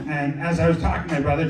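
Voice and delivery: usual speaking voice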